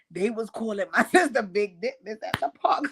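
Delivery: words giving way to real laughter; laughing through the sentence; trying not to laugh. Real, not acted. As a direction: hold it in, then crack up. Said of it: "let me stop laughing 'cause it's not funny".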